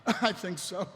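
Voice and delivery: high, halting, choked start settling down; catching breath between words; recovering from coughing. Acted. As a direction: stammer it out after a coughing fit.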